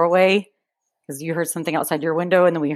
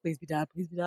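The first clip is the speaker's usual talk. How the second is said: much softer, pleading under the breath; mock scared whisper; 3 semitones lower, 13 dB quieter than usual. A frightened kid seeing a figure in the doorway, hushed pleading. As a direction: beg under your breath, terrified.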